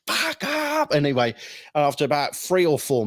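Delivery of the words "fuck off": much higher, a yelled example; demo yell. Retold or imitated. Psychiatrist demonstrating the shout to his patient, high yell. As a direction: show him how to yell it. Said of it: "Louder, louder, more energy".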